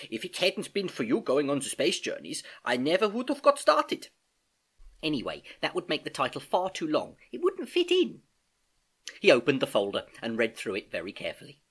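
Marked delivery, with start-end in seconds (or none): 0.1-8.2 animated uncle, rising high at the ends; lightly acted, generous older relative; lightly voiced encouraging uncle. Uncle crediting his niece for her help, encouraging.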